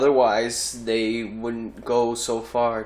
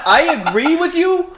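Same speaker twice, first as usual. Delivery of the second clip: very high, loud squeal of agreement; exasperated insistence; 6 dB louder than usual. Real, not acted. Cutting into a friends' phone debate, high exasperated insistence. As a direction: push it way up high to get heard. Straining to be heard in argument.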